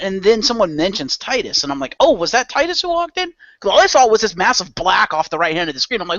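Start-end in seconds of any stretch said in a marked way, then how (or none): 2.0-3.3 high, mock-surprised question; surprised realization. Watching Titus walk in, high mock surprise. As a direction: lift it up in sudden recognition. Narrator's own reaction retold.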